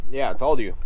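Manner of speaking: normal talking voice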